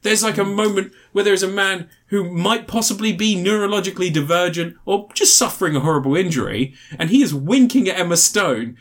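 usual speaking voice

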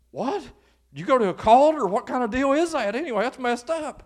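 shocked disbelief. Acted. Acts a shocked listener's reply.

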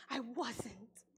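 breathy whisper, almost unvoiced; whispered aside. Real, not acted. Whispered to the congregation, admitting she wasn't close. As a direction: lean in and whisper the confession.

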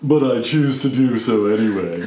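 haughty, superior little voice; snobby voice. Acted. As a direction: look down your nose as you say it. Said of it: "That is the best snobby voice for this".

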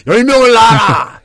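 loud, slow proclamation; booming blessing. Pretend. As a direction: bellow it like a solemn blessing. Mock blessing, shouted. (크게 축원하듯 외치기)

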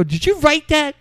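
high, loud amazed question; delighted. Retold or imitated. Asking the friend about his line, amazed and high. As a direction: ask in delighted awe.

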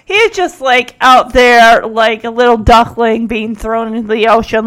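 usual speaking voice

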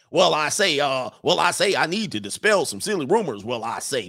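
blustery Southern cartoon rooster impression; Foghorn Leghorn. The attorney dispelling silly rumors, read as Foghorn Leghorn. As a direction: open big, swing the pitch, drop on the tag. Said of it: "read this in my Foghorn Leghorn accident".

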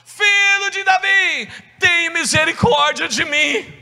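high, loud, drawn-out plea to Jesus; acted cry for mercy. Blind woman crying out to Jesus, loud desperate plea. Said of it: "ela começou a gritar".

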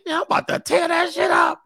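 high, hammed-up excited shout; mock bravado, voice leaping high; hyped-up boasting. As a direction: crow it like an overeager guy. Acted cocky man boasting.

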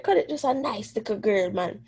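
normal talking voice

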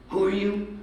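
retold question pitched well up; mock challenge. [name] questioning the new music director, mock challenge.

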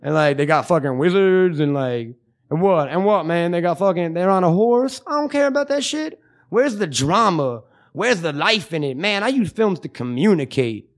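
high mocking dismissive rant; impression of Jesse. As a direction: ham up a stoned friend's rant. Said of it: "you do a wonderful impression of me".

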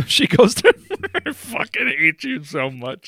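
said through laughter; helpless laugh breaking into the words; exasperated but cracking up. Real, not acted. Cracks up at co-host mid-story.

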